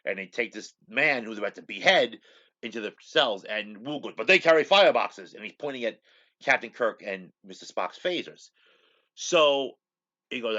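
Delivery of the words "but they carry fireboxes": alarmed outburst. Retold or imitated. Wu alarmed, pointing at phasers.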